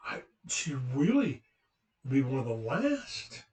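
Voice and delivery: imitated posh English hostess, swooping pitch; posh English disbelief; posh English accent. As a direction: play an astonished English lady with a posh accent. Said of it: "she had this posh English accent".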